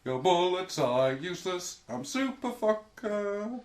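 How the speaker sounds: mock superhero bravado; hammed-up superhero. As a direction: boom it out like a cartoon hero. Playing a superhero boast.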